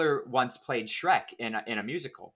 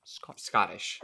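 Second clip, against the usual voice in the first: Scottish said lower, less English-accented; contrasting attempt; 5 semitones lower, much slower than usual. Shown as a demonstration. Opposing the English-sounding take, lower Scottish accent attempt. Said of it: "as opposed to".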